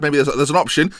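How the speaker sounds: normal talking voice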